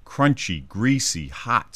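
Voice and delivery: normal talking voice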